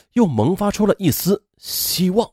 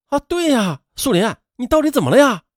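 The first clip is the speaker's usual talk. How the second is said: concerned, higher-pitched questioning; 关切询问; 8 semitones higher than usual. A narrator's reading. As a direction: ask a friend anxiously what's wrong. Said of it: "纷纷关切地询问". (关切而着急地询问)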